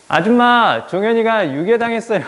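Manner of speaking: put-on high, loud child's alarm; frantic child. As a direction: call out like a panicked kid with big news. Friends report kidnapping to mother.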